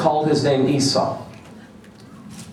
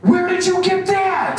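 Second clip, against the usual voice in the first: much higher, louder, slow amazed cry; amazed outburst. Retold or imitated. Boy blurts out to the designer, high amazed cry. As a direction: blurt it out in awe, high and loud. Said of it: "Right out loud".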